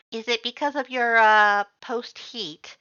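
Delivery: high, loud skeleton voice; awkward, booming question; Papyrus voice. A narrator's reading.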